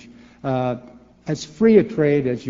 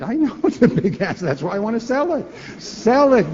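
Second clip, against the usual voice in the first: laughing, then high and loud on the last "Sell it"; tickled and emphatic, topping the objection; amused rebuttal building up; 8 semitones higher, 3 dB louder than usual. Real, not acted. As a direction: start chuckling and build to a high, loud punch.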